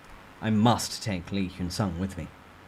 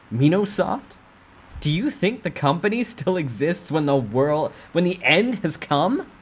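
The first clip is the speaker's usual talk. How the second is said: angry retort; 5 semitones higher, 4 dB louder than usual. Acted. Narrator snaps at the boss.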